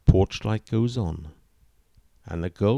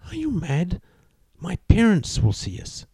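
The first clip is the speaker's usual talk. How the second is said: put-on high, alarmed young woman; horrified; 9 semitones higher than usual. A narrator's reading. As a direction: gasp it out, shocked.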